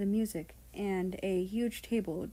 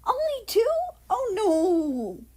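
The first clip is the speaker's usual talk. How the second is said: squealed much higher and louder; 13 semitones higher, 8 dB louder than usual. Acted. Japan squealing at friends about the dance, high squeal. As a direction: shriek it in panicked delight. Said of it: "Japan squealed".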